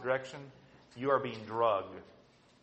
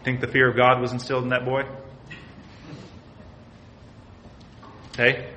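fast, much louder pointed question; loud challenge; 7 dB louder than usual. Real, not acted. Preacher confronting the congregation, loud and pressing. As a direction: throw the question at the room.

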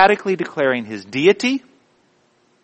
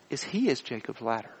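ordinary talk

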